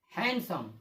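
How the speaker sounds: "हैंडसम" said han-sum, no D; silent D. Shown as a demonstration. Teacher reading an example of the rule, silent D. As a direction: drop the D in "हैंडसम". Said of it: "ये डी silent होगा".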